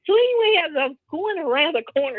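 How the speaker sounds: usual speaking voice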